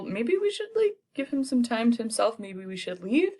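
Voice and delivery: high, hesitant suggestion; a high, unsure courtier voice; awkward lords. Retold or imitated. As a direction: tiptoe out like uneasy nobles.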